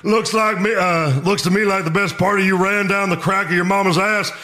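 barking drill instructor, high and loud; drill sergeant. Acted. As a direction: bark it like a furious sergeant. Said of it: "I'll be Stone Cold as Hartman".

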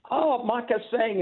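high, drawn-out exasperated outburst; imitated going off. Announcer going off at wrestler Micah Singh, high imitated outburst. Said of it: "he's going off".

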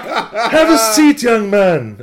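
loud, high, slow imitated Armenian accent; grandly drawn-out Armenian-like accent; Fausto Vitello. Old boss seating a young fan, imitated Armenian-like accent. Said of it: "I can't do the accent".

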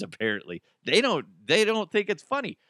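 usual speaking voice

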